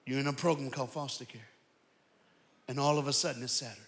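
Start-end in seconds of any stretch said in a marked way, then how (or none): 0.0-1.5 quiet, voiced near-whisper; softly lowered voice imitating dad; retold hushed whisper. Dad breaking the news to his son, hushed. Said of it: "my dad whispered".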